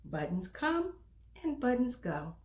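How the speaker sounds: put-on bright, lively read-aloud for little kids; reading to children. A narrator's reading. Storyteller reading aloud to children, animated storytime voice.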